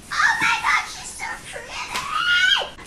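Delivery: extremely high, loud, stretched squeal; excited squeal. Real, not acted. Vlogger seeing her new air fryer, delighted squeal. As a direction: squeal with delight, rising to a shriek.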